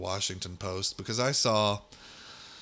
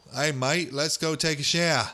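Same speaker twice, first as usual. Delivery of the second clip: higher-pitched put-on Australian accent; broad mock Australian, pitched up; 6 semitones higher, 4 dB louder than usual. Shown as a demonstration. Host showing off the one Australian line he can do, Australian accent. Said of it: "an Australian accent".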